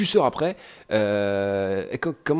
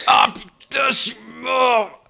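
frustrated shout; 11 semitones higher, 5 dB louder, much slower than usual. Real, not acted. Crashes in the game.

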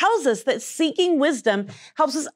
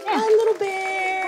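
cooing; 5 semitones higher, slower than usual. Acted. Cooing at the bear.

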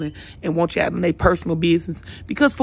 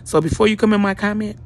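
ordinary talk

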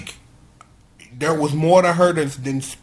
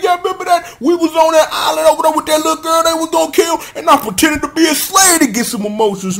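high, worked-up pleading; mock exasperation; 9 semitones higher, 4 dB louder, faster than usual. Recapper voicing Naga reminding friends of the island, mock exasperation. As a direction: protest it in a hammed-up rush of frustration. Naga's worked-up protest, acted.

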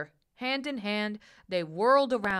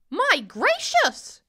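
high, loud, drawn-out exclamation; slow, startled cry from a jay; astonished cry; 12 semitones higher, 8 dB louder, much slower than usual. A narrator's reading. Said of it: "she cried".

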